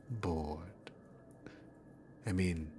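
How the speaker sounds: breathy teasing whisper on the word; lazy whisper of boredom. Acted. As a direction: drop to a toy-bored whisper. Teasing whisper to captive hero.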